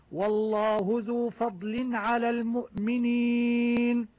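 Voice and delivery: slow chanted recitation, every letter clearly sounded; Quran recitation. Shown as a demonstration. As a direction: recite the verse, sounding each izhar clearly. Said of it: "جميع أنواع الإظهارات". (تلاوة مجودة بإظهار واضح)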